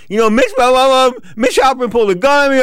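high, loud whiny complaint; loud whining to the commission head. Retold or imitated. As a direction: whine it like a wronged kid. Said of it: "I'm whining".

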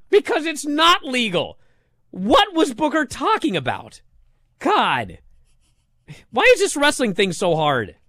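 worked-up complaint, pitched well up; exasperated rant. Real, not acted. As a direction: argue it in baffled exasperation. Exasperated rant at commentator's claim.

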